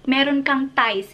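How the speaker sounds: ordinary delivery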